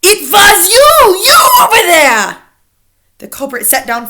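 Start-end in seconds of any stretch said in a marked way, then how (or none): 0.0-2.4 loud, high accusing shout; furious shout. A narrator's reading. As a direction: point out the culprit in rage. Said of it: "she shrieked".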